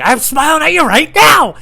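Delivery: high, loud, drawn-out yell through a forced smile; angry smiling scream. Retold or imitated. Employee right in the customer's face, screaming through a smile. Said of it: "angry smile".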